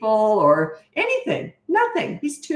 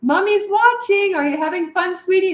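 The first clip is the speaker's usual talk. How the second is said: calling out sweetly; 12 semitones higher, 4 dB louder than usual. Retold or imitated. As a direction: call across the yard sweetly and high. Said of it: "I'd call".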